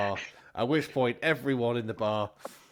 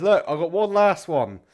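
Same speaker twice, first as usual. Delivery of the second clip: loud, higher comic voice; showy stage patter; 8 semitones higher, 10 dB louder than usual. Acted. Comedian announcing one last joke, showy. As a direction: call it out over the crowd.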